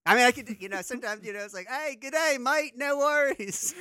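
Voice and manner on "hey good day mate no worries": high, loud, hammed-up Aussie accent; Australian accent. American guest showing hosts his occasional Aussie, mock Australian accent. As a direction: put on a broad, jokey Australian greeting. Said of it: "Australian accent".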